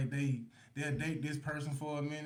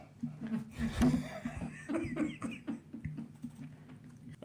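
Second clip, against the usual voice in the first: long airy squealing laugh; high wheezy laugh; 5 dB quieter than usual. Real, not acted. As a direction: crack up, breathless and high. Cracking up at married life.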